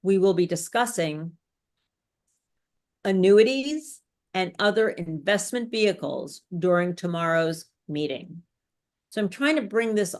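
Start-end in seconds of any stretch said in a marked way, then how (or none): 0.0-8.4 long pause after "discussing", then stress "annuities"; calm authority, "annuities" and "investment" stressed, dropping at the end. Shown as a demonstration. Coach rereading the example, pausing before "annuities" and stressing it. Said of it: "I paused after discussing".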